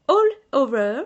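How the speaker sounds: "all over" said in a French-English accent. Shown as a demonstration. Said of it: "mon accent". ("all over" avec l'accent anglais)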